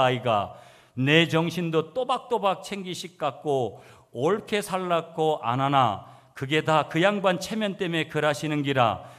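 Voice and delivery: animated, emphatic Gyeongsang-dialect recitation. Shown as a demonstration. Preacher reads third psalm version to congregation, in animated Gyeongsang dialect. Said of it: "경상도 버전 삼 번도 읽을게요".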